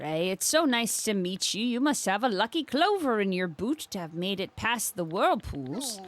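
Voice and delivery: a hint of a Minnesotan accent, voicing a friendly beekeeper; light Upper Midwest lilt with airflow held back. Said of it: "restrict your airflow just a little bit".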